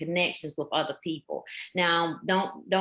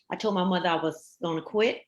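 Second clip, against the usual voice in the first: ordinary delivery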